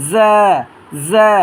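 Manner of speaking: জা opening on a buzzing Z; bee-like buzz. Shown as a demonstration. Teacher models a letter for learners, buzzing onset. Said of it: "মৌমাছির আওয়াজের ন্যায়".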